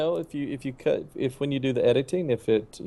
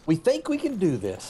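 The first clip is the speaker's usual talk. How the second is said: prim loan officer; 8 semitones higher than usual. Retold or imitated. Retold loan officer's verdict.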